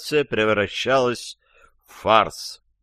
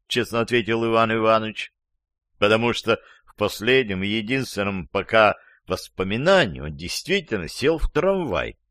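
ordinary delivery, nothing marked

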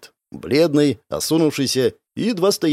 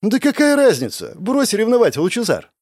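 higher, brisk brush-off; dismissive; 8 semitones higher than usual. A narrator's reading. Girl brushing off her friend's jealousy, dismissive. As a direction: wave it away in a higher voice. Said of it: "затем и вовсе отмахнулась".

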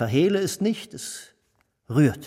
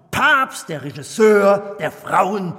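grand loud announcement; 8 dB louder, slower than usual. Acted. Minister praises director loudly.

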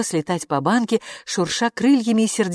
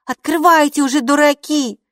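louder, high, exasperated scolding; impatient shout; 9 semitones higher, 6 dB louder than usual. A narrator's reading. Hilla yelling at the cowards behind the door, exasperated. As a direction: snap at the scaredy-cats to open up.

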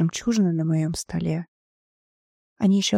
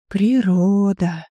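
slow, drawn-out and louder; 5 dB louder, much slower than usual. A narrator's reading. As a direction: stretch the word out slowly. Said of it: "протянул Йонсон".

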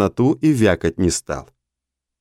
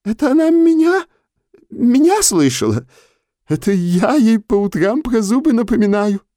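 put-on much higher, astonished old man; stunned; 14 semitones higher than usual. A narrator's reading. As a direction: gasp it out in disbelief. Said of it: "обомлел Наум Моисеевич". (ошеломлённо, очень высоко)

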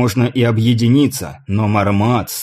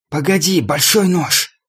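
furious hissing voice, higher pitched; whistling s; 5 semitones higher than usual. A narrator's reading. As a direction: hiss «Погоди, большой нож» with rage. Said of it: "Свистящий от ярости голос Мармада". (свистящий от ярости голос)

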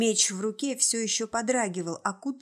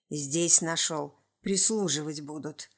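demon voice; 5 semitones lower than usual. A narrator's reading. Demon speaks.

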